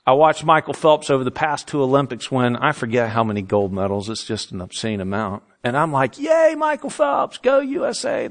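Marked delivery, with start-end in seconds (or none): 6.2-8.3 high-pitched fan cheer; mock cheering. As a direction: cheer like an excited spectator at home.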